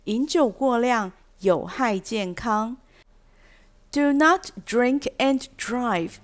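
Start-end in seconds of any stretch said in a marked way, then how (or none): 3.9-6.1 slow, deliberate warning voice; stern warning. Shown as a demonstration. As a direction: read it like a public safety notice. Reading the legal warning. (缓慢严肃的警语)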